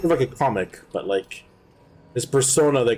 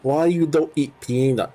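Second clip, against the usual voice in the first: higher, mocking Malaysian-Chinese accent, last "So weak" shouted; mock Uncle Roger accent; 5 semitones higher, much slower than usual. Friend imitating the comedian's accent, hammed-up Malaysian-Chinese accent. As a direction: taunt in a put-on uncle accent. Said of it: "that's a fake accent".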